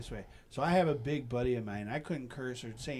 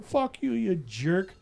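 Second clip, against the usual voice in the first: mock angry; 5 semitones higher, 6 dB louder, much slower than usual. Cursing the dead cat.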